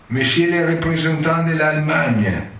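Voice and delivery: high, booming ceremonial call; stentorian usher. Retold or imitated. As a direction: announce to the whole hall. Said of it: "con voce stentorea andava annunciando". (voce stentorea da usciere)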